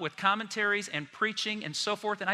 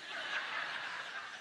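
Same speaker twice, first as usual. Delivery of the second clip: congregation laughter; 12 dB quieter than usual. Real, not acted. Congregation laughs at afro joke.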